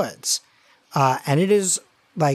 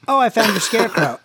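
high, loud and quick; animated in-character echo; 6 semitones higher, 5 dB louder, faster than usual. Acted. Game master echoes the player's line, loud quick call. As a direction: call it out fast with raised pitch. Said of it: "So you say".

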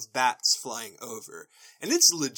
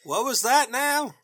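put-on old granny voice; 10 semitones higher, 4 dB louder than usual. Granny mishearing in recap.

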